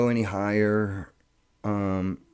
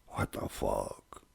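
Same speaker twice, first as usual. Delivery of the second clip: astounded doctor; 12 semitones lower, 14 dB quieter, slower than usual. Retold or imitated. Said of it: "Doctor's astounded".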